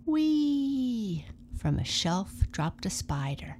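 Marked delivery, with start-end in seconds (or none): 0.0-1.3 long high falling "whee"; drawn-out swooping cry; gleeful "whee". A narrator's reading. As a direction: squeal it sliding down from high. Spider drops from shelf.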